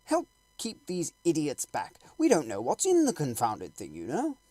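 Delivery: a harried astronomer ordering the crowd off; loud, irritated gentleman's appeal; Ogilvy, exasperated. Acted. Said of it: "said Ogilvy".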